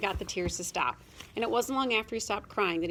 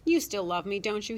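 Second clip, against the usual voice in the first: ordinary delivery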